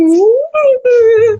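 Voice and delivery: acting out a high, loud squeal of eagerness; excited squeal. Livestream guest showing eagerness for missions, excited squeal. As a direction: squeal like you can hardly wait. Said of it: "eu tô tipo".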